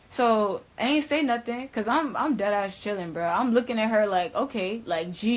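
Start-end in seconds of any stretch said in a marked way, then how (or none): none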